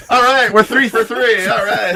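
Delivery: laughing through the words. Real, not acted. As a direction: chuckle it out, amused. Laughing at shared bad roll.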